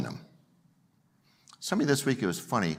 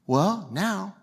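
ordinary delivery

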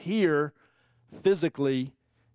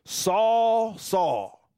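passionate call; 4 semitones higher than usual. A narrator's reading. Divine voice calling Saul from the light, passionate. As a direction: call out slowly with feeling, falling on the repeat. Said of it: "it's really a passionate word".

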